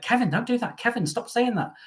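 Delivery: high-pitched mock telling-off; imagined scolding partner. Imagining Nick at home scolding Kevin, mock telling-off. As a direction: imitate an exasperated partner, pitched right up. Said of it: "Poor Nick at home's probably like".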